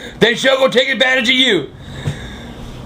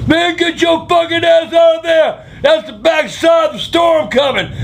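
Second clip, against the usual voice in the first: mock holler, higher and urgent; hollered warning; 7 semitones higher than usual. Neighbor yelling out the window about the storm, mock holler. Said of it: "Somebody holler out the window".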